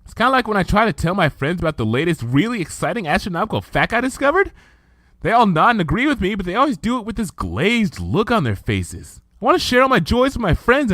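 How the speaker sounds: fast, high, tumbling enthusiasm; Twilight's excited ramble. Acted. Twilight confiding her lonely passions to Luna, excited then wistful. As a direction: gush about astronomy, then sag.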